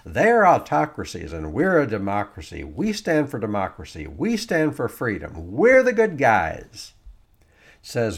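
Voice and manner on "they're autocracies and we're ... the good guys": mock patriotic boast. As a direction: play a smug booster crowing about being the good guys, slowing on the last line. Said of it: "It's like".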